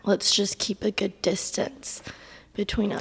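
hushed and voiceless; lost voice. Real, not acted. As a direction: whisper it, no voice left. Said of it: "I can't talk back".

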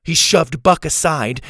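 plain reading voice

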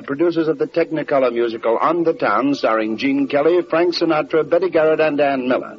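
usual speaking voice

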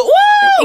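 very high, loud cheering woo; crowd woo. Retold or imitated. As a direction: whoop like a dancing crowd. Crowd cheering at [name].

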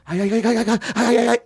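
high-pitched, exaggerated stammer; stuttering. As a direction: trip over every sound, mock panic. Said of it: "every word is, like, stutter".

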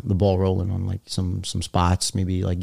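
normal talking voice

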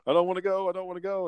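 a hint of the kid begging not to go; mock pleading. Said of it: "And he's like".

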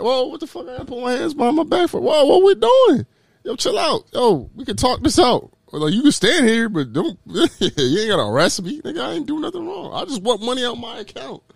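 high, pleading protest at a cop cuffing him; alarmed mock protest. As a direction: protest in disbelief, trying to calm the cop down. Acting his protest at an arresting cop.

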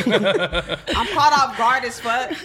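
laughing, high and loud, caught off guard; laughing in surprise. Real, not acted. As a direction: laugh through the shock. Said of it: "I'm surprised".

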